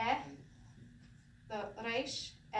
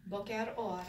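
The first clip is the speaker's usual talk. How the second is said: Israeli reply; 3 semitones lower than usual. Retold or imitated. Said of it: "an Israeli is gonna say to you".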